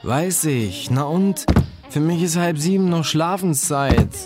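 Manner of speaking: high, exasperated comeback; annoyed, pitch well up; irritated retort. A narrator's reading. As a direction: snap back as the woken sleeper. Sleeper argues back at roommate.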